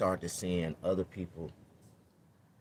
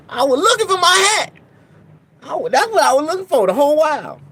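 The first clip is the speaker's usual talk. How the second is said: high, loud and frantic; panicking over a lost hat; 17 dB louder than usual. Real, not acted.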